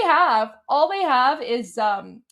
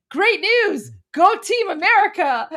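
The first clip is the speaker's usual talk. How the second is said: bright, higher mock cheer; 6 semitones higher, 3 dB louder than usual. Mocking their post with a fake cheer, higher. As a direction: cheer it like a fan. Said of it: "I was like".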